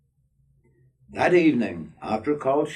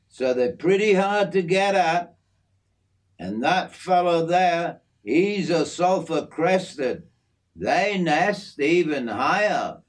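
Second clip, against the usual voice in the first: a lightly voiced friendly Aussie bloke, slightly raised; easygoing outback mate showing off his aviary; hint of a broad Australian working man; Aussie bloke Jim; 9 semitones higher, 3 dB louder than usual. Jim names the birds.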